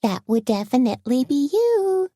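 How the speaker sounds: high sing-song teasing lilt. Acted. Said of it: "Tony said in a sing-song voice".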